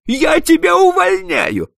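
shrill, very high, loud screaming; a boss screaming threats at his clerk; hysterical rage. Acted. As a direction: scream each order, losing control.

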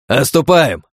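loud commanding yell; shouting. A narrator's reading. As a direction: call the retreat over the noise. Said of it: "крикнул мечик".

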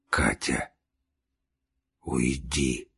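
very low, soft and effortful; weak dying voice. A narrator's reading. Dying man sends Katya away, weak and low. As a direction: speak faintly, deep down in the voice.

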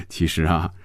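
soft chuckle before speaking; chuckle, then begin to explain; amused. A narrator's reading. Hongjun answers Phoebe's question with a laugh, amused. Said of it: "红军笑着说".

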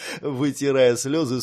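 ordinary delivery

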